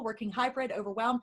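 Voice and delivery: normal talking voice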